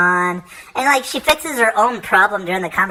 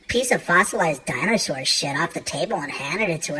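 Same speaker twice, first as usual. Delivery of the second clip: normal talking voice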